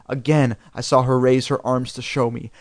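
usual speaking voice

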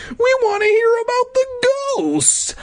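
fast, very high squeal; calling out. A narrator's reading. Hero calling after the fleeing Sadlers, squealed plea. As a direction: squeal after them in a kid voice. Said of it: "I called".

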